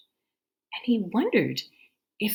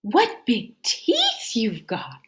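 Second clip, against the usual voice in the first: put-on girl's wonder; 5 semitones higher, 6 dB louder, slower than usual. Girl's last question.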